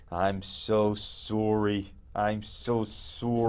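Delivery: slow, soft Canadian "soory"; mock Canadian apology. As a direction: draw out a hushed Canadian-accented apology. Callback to the film's slow apology.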